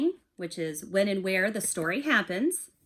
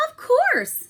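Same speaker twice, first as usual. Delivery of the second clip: much higher and louder, bright greeting; cheery Hare; 9 dB louder than usual. Acted. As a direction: pop it out eagerly.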